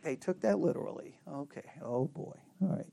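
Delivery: low, very soft deflated aside; quiet, let-down mutter to yourself. Retold or imitated. Teacher privately reacting when students miss his sarcasm, deflated muttering. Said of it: "you'll be like".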